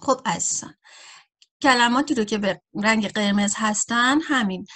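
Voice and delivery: usual speaking voice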